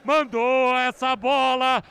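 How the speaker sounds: slow, shouted goal call; explosive goal commentary. Real, not acted. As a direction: roar the goal out.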